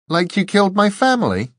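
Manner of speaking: accusing retort. A narrator's reading. Dor to the angel that killed the family, accusing. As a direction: throw the accusation back, pitched high.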